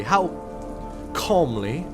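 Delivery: plain reading voice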